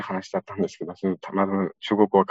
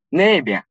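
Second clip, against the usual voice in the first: ネイビャン raised and louder, for a kilometre off; ネイビャン pitched up, stronger, further away; farther-distance tune; 4 semitones higher, 5 dB louder, faster than usual. Shown as a demonstration. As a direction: lift ネイビャン higher and louder to show distance. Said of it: "一キロメートル先は".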